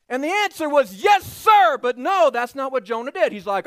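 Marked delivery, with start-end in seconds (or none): 1.0-1.8 high loud snap of obedience; mock eager yes. Jonah answering God, loud mock eager. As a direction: shout it like a soldier.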